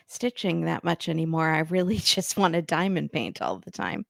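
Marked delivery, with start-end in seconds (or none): none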